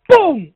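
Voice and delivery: loud, high burst of "pum"; imitated explosion boom. Survivor recreating the first blast for the interviewer, explosive boom. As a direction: shout the bang out suddenly.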